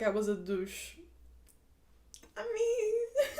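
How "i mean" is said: very high, drawn-out "I mean"; high-pitched hedging. Real, not acted. Reacting to the storyteller looking like a douche, high amused hedge. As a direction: squeak it out high, on the edge of laughing.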